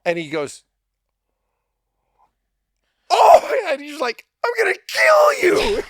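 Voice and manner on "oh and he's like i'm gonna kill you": very high, loud yelling; furious shout. Retold or imitated. Friend realizing the prank, yelling he'll kill him. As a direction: explode with mock rage.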